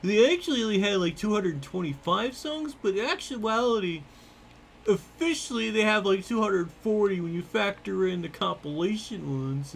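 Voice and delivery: high, eager know-it-all nerd; nerdy voice. Pretend. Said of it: "it's my nerdy voice".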